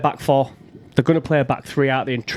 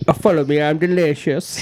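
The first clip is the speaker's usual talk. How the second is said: fruity Irish impression. Mimicking an Irish TikTok guy for his mates, hammed-up Irish accent. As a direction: belt out a camp Irish TikTok catchphrase. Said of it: "That was a bit fruity".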